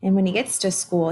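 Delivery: plain reading voice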